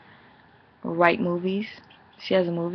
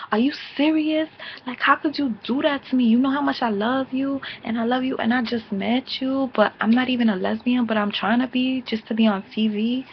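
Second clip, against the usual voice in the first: higher mock whine of betrayal; Sin's hurt rant, retold higher; imitating Sin; 5 semitones higher than usual. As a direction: whine it high like a hurt twin. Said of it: "She's like".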